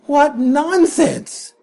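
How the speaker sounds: normal talking voice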